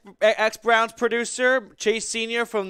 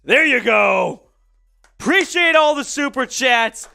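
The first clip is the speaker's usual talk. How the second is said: excited shout; 5 semitones higher, 5 dB louder than usual. Real, not acted. Cheering the chug, thanking chat.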